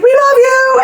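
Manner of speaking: high loud mock fan call; crowd yelling. Fans calling out to Beth, high loud yell. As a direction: shout love at the stage. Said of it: "Everyone's like".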